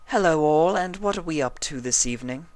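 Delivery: high, refined pony lady; Rarity's voice. A narrator's reading. Rarity greeting the friends, high and refined.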